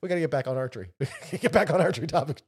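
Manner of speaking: words through laughter; laughing. Real, not acted. Host steering podcast back to archery, laughing.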